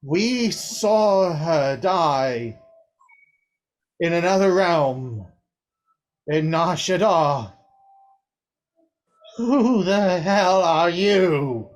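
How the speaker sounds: angry father. Acted. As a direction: drag out each word in anger. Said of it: "says the father".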